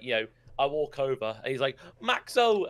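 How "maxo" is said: imitated shout. A mate spotting him as he walks over, imitated shout. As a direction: call it out like a mate greeting you. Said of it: "he, like, shouts it".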